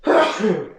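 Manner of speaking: sudden loud sneeze; real sneeze on camera; sneezing. Real, not acted. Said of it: "Sorry, I'm gonna sneeze".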